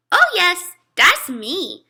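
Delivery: put-on high, loud little-kid insistence; proud toddler claiming himself. A narrator's reading.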